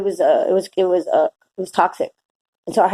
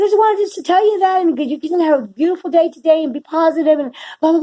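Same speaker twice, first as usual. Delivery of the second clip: beaming stranger's blessing; 8 semitones higher than usual. Retold or imitated. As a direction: bless her like a kind old man grinning. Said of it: "he goes".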